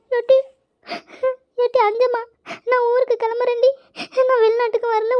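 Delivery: high-pitched weepy wail; tearful complaint. Acted. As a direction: sob out your grievance to a sister. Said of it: "அக்கா உட்காந்துக்கிட்டு அழுதுட்டு இருக்கு". (அழுதுகொண்டே புலம்பும் குரல்)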